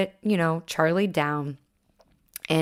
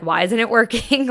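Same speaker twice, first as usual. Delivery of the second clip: exasperated; 5 semitones higher, 7 dB louder than usual. Retold or imitated. Retells frustration with breathing exercises.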